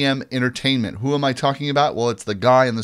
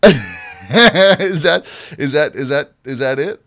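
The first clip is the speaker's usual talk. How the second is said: laughing; 3 semitones higher, 6 dB louder, much slower than usual. Real, not acted. Laughing at the accent question.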